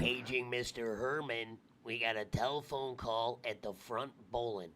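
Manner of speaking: low, soft PA announcer; flat echoey pa page. Pretend. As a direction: read it like a store intercom. Mock PA announcement.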